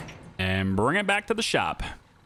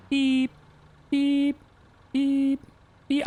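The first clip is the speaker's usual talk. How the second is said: high, silly beep beep; much slower than usual. Pretend. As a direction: beep like a toy car, just goofing. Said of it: "I'm just being silly at this point".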